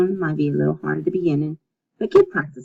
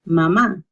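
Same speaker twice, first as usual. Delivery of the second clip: "mamá" with the second ma stressed; stress on last syllable; 5 dB louder than usual. Shown as a demonstration. Teacher showing the accent mark, "mamá" stressed on the end. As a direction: lean on the final á in "mamá". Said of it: "There's emphasis on the last".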